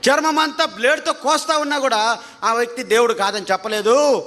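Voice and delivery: ordinary talk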